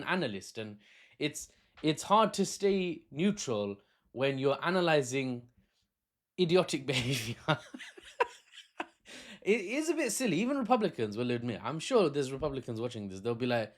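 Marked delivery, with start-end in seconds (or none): 6.4-10.4 breaking into real laughter; laughing in disbelief. Real, not acted. Analyst fails to stay neutral about Trump, laughing. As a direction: try to stay neutral, then crack up.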